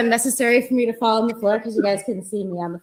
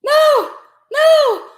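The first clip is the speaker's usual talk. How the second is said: hammed-up death screams. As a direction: scream like a melodrama victim. Acted death shrieks.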